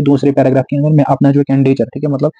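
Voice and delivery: ordinary talk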